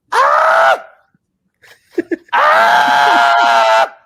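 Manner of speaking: high excited scream with a laugh; retold screaming. Telling cohosts how he screamed at the Rodgers news. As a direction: yell with pure joy at the news. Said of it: "And I start screaming".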